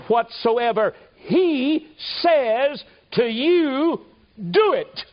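preaching at full force. Acted. Preacher to the congregation, soaring high and loud. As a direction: lift the church with each line. Preaching climax on Mary's words.

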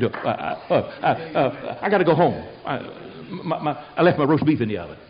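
stuck on each syllable, then a hurried excuse; nervous stammering. Acted. Preacher imagines being asked to unwrap him, mock nervous stammering. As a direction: stammer comically, hunting for a way out.

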